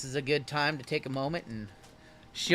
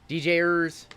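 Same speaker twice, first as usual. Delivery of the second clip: usual speaking voice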